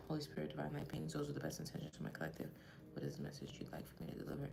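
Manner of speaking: hushed, fast whispered prayer; quiet prayer. Acted. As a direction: pray softly to the spirit guides. Prays to spirits before reading.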